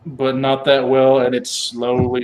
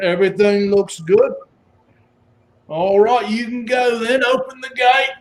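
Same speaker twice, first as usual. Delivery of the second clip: higher, casual guard waving them through; 9 semitones higher than usual. Acted. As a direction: play the bored gate guard. GM voices guard letting through.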